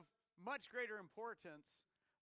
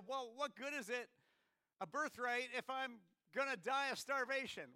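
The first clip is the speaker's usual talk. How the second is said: raised, pleading voice of a starving brother; Esau's protest; 6 semitones higher, 7 dB louder than usual. Retold or imitated. Preacher voicing Esau's hungry protest, raised and pleading. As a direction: whine it like a hungry man begging off his duty. Esau pleading over the stew.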